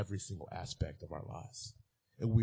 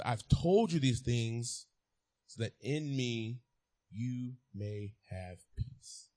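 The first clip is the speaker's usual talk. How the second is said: slow, deliberate reading of Jesus' words; preacher reading Jesus' words to the congregation, slow; scripture reading; much slower than usual. Acted. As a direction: read it slowly and solemnly.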